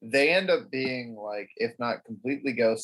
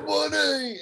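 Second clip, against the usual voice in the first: "Money!" shouted much higher, like a TV ad; commercial shout; 14 semitones higher than usual. Retold or imitated. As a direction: yell it like the punchline of an ad. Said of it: "The sound comes".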